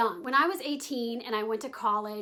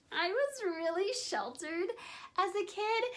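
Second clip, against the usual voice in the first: high ditzy airhead tone; bimbo voice; 8 semitones higher than usual. Pretend. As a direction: say it like a giggly party girl. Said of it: "I will cut the bimbo voice out".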